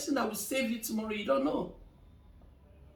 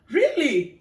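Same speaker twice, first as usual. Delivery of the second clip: surprised really; 3 semitones higher, 9 dB louder than usual. Retold or imitated. Client's shocked reply.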